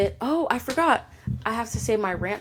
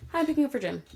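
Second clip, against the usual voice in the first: higher, lightly voiced polite driver; mock polite driver; 7 semitones higher, faster than usual. Cashier imitating the polite driver at the window, higher mock voice.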